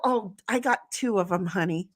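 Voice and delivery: ordinary talk, nothing marked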